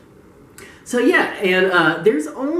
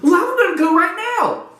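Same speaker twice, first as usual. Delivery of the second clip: high, squeaky mock eagerness; eager little fan; 12 semitones higher, 3 dB louder than usual. Fan rushing off to tease a rival, squeaky eagerness. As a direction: announce it in a high, childlike put-on voice.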